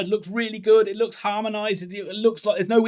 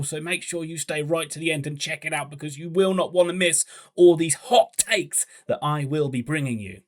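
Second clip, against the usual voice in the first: ordinary talk, nothing marked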